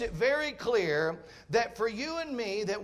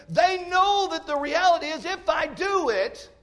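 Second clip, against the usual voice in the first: higher, lively preacher's storytelling; fervent preaching; 4 semitones higher than usual. Acted. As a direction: paint the crash for the congregation. Preacher's rollerblade illustration, raised voice.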